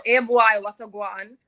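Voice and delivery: loud Jamaican patois greeting; Jamaican accent. Shown as a demonstration. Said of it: "What did you say when you said it?".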